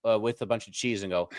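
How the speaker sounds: usual speaking voice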